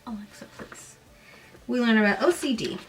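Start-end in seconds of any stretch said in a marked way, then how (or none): 0.1-1.0 whispered aside. Real, not acted. Aside to a smart speaker.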